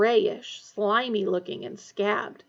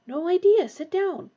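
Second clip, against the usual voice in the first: higher character voice; girl's voice; 8 semitones higher, 4 dB louder than usual. Acted. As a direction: voice a girl in the dark.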